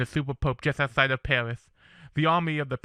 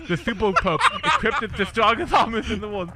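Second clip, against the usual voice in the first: laughing; 7 semitones higher, 5 dB louder than usual. Real, not acted. Narrator laughing mid-read.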